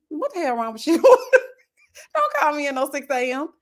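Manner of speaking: high protest breaking into laughter; mock outrage, laughing. Granddaughter retelling her grandma's six A M calls, laughing outrage. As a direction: complain in mock outrage while cracking up.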